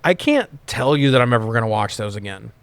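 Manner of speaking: ordinary talk, nothing marked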